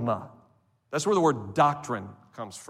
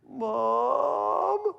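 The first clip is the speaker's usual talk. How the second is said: put-on high, drawn-out child's whine for mom; little Johnny; 10 semitones higher, 3 dB quieter than usual. Frightened child calling mom from the bedroom door, high mock whine. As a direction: imitate a small child calling out at night. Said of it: "That's little Johnny".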